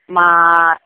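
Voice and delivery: ordinary delivery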